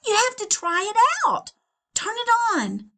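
high, bright salesman pitch; Donald's sales pitch. Acted. Husband pitching his new vacuum to his wife, high eager salesman voice.

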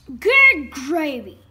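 loud, slow exclamation; startled shout. A narrator's reading. Coach recoils from a sick turkey, loud shout. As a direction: shout each word out long. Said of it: "shouted the coach".

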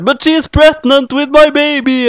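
high, drawn-out wail; dramatic protest. A narrator's reading. Doctor Who protests that Rose is pregnant, high wail. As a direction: cry it out high and slow.

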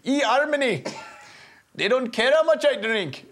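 a clumsy stage-Irish brogue pitched high; bad Irish accent. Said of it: "I'm from Ireland".

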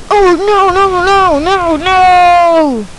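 high drawn-out "no" wail; high-pitched cry of dismay. Acted. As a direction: wail in dismay, holding the last no. Reacting to disaster in the game.

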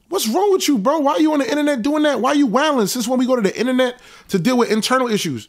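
retelling how he pushed back on his friend; retold heated disbelief. On the phone with his friend, high incredulous mock outrage.

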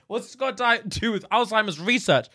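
high, bewildered pleading; acted confusion. Son confused by the research video, high bewildered voice.

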